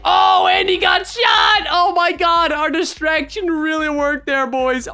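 high, loud, stretched-out amazement; excited gamer outburst easing slightly; thrilled shout. Real, not acted. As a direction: yell it in triumphant disbelief. Distraction got murderer shot.

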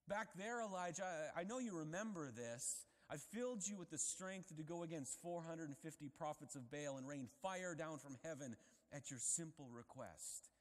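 loud, high, fervent preaching; preacher pressing the point hard; preaching at full force. Acted. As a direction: thunder the reminder.

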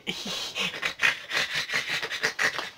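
long, soft, voiceless laugh; wheezing laugh. Real, not acted. Collector cracks up at his own bad joke, wheezing laugh.

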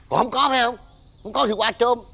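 high, testy insistence; insistent outburst. Acted. Father telling his child to go steal fruit, testy and insistent.

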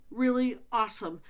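ordinary delivery

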